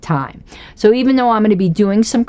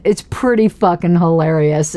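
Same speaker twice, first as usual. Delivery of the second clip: ordinary talk, nothing marked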